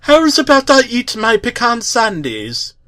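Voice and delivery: put-on Roger's queasy Scottish accent. A narrator's reading. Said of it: "unusual Scottish accent".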